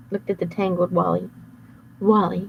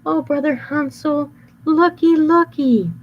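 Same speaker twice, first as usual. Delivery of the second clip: high put-on Gretel voice; overly sweet, playing innocent; fake sweet girl; 8 semitones higher, 3 dB louder, slower than usual. Wolf disguised as Gretel luring cookie, sweet.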